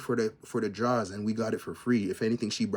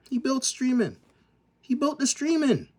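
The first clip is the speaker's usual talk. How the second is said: much higher, louder, insisting he built streaming; exclaimed insistence; 14 semitones higher, 4 dB louder than usual. Real, not acted. Vlogger insisting to viewers that [name] built streaming, near-shouting. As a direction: hammer the point home, pitched way up. Repeating his claim, rising in pitch.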